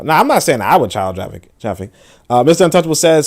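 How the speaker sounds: ordinary delivery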